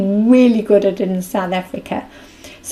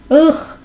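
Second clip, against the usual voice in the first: loud high ugh of disgust; sharp disgusted ugh; disgusted groan; 7 semitones higher, 5 dB louder than usual. Real, not acted. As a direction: groan at your own words. Disgusted at her own words.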